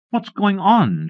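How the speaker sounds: sharp high demand, slowly. A narrator's reading. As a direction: demand it from the stairs. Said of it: "she demanded".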